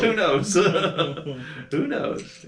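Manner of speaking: real chuckle through the words; a giggly shrug of a question; laughing. Real, not acted. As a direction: laugh it off, high and amused. GM laughing at the cheap house.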